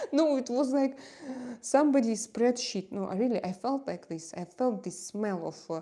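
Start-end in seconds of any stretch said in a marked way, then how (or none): 0.1-2.9 higher and louder, half laughing; loud amused outburst; animated correction. Real, not acted. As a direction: burst out correcting yourself with a laugh. Laughing correction about bot attack.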